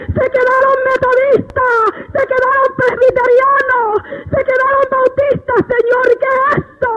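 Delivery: high, tearful lament in a chant-like run; distraught wailing. Retold or imitated. Preacher relives seeing churches left behind, high tearful wail. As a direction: wail each group as if watching them pass.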